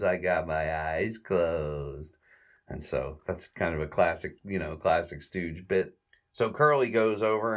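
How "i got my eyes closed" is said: slow deadpan reveal; deadpan answer. Retold or imitated. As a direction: drag it out flat and dumb. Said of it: "And he goes".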